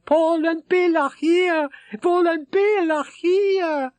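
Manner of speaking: very high, slow happy yell; excited yelling. Acted. Rashid runs into the suite yelling the news. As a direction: call the news out to the room.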